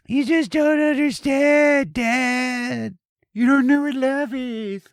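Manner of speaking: very high mock teenage wail; piercing, hammed-up protest; lovelorn Bigfoot daughter. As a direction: whine it like a melodramatic teen at dad. Voicing the lovelorn daughter arguing back.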